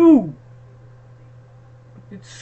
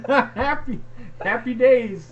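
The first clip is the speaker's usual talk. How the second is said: loud laughing higher cheer; laughing toast; 8 semitones higher, 6 dB louder, slower than usual. Real, not acted. Husband laughing and toasting both of them.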